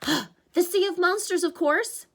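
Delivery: put-on a panicky young satyr; Grover's voice. Grover answers, drawn out.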